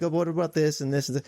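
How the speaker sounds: rapid, high-pitched imitation of a doubter; mock skeptical objection. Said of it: "I didn't really buy it".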